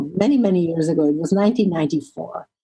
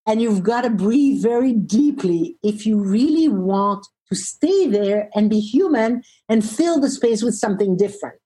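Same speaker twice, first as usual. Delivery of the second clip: ordinary talk, nothing marked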